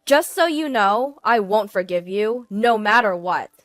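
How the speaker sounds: loud, forceful angry threats; wife erupting at her cheating husband; furious tirade. Acted. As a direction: tear into him and cut him off. Wife's furious final threats to husband.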